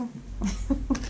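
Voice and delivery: breathy giggle. Real, not acted. Laughs about relationships card.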